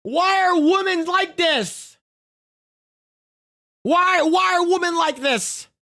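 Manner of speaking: high, drawn-out exasperated cry; why are women like this, pleading to the sky. Real, not acted. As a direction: throw your hands up at women.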